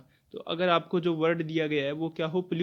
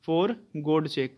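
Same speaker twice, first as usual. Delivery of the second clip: slow dictation; 3 dB louder, much slower than usual. Shown as a demonstration. Dictating example phrase.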